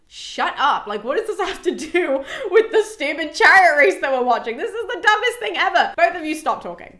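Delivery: put-on a fed-up king shushing children, half-laughing; mock scolding. Agamemnon scolds the bickering pair.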